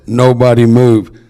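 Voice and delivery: loud, slow command; commanding order. Retold or imitated. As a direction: bark it out slowly so everyone freezes. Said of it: "So I said".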